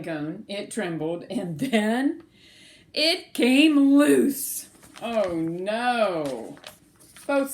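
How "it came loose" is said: slow and loud, drawn out; dramatic slow reveal. A narrator's reading. Reader telling children the cone came loose. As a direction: stretch each word as it drops.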